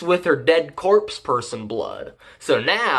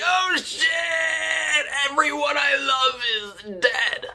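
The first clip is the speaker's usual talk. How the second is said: hammed-up cry of grief, stretched high; mock anguished wail; 11 semitones higher, 4 dB quieter than usual. Hero finds his family dead, mock anguished wailing.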